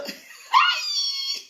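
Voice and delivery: big laugh; loud high laugh. Real, not acted. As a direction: burst of shrieking laughter. Laughs loudly.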